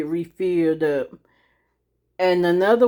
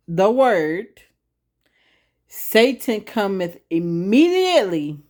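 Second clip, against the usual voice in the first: slow, slightly raised and stressed verse; slow emphatic reading; 3 semitones higher, slower than usual. Shown as a demonstration. Preacher rereading the verse slowly for emphasis. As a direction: lean into each word. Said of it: "Let me read it again now".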